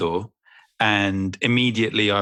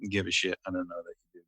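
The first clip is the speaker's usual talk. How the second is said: usual speaking voice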